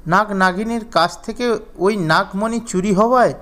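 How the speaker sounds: ordinary delivery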